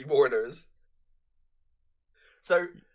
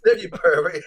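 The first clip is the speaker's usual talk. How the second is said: louder mock retort; 4 semitones higher, 11 dB louder than usual. Co-host repeats Randall's line with a louder, punchier retort. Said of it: "he says".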